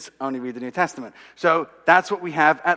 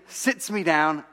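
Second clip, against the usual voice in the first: ordinary delivery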